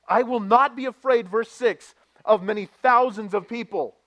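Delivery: loud, high, fervent sermon voice; full-force preaching. Acted. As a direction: preach it out to the church. Preaching scripture loudly.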